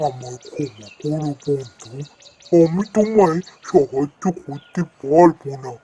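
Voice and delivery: lightly voiced wise old tree, calm; a hint of a gentle talking tree; tree friend voice. Tree friend refuses help.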